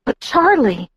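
high, panicked objection; fearful protest. A narrator's reading. Bella fears for her father, panicked protest. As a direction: plead in alarm for someone's safety. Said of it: "The fear was squeezing my chest".

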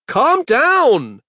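loud, high yell. Acted. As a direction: bellow it over the scream. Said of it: "he yelled at his son".

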